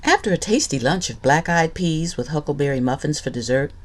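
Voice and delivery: ordinary delivery, nothing marked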